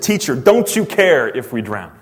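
urgent, accusing question. Acted. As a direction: cry out in reproach to a teacher. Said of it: "definitely some accusation here".

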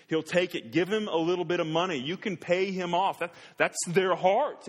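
full-force pulpit preaching; fiery preaching. Acted. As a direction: hammer the point home to the congregation.

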